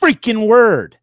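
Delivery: high, exasperated scolding. Real, not acted. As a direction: scold them, fed up. Scolding co-host for saying trigger word.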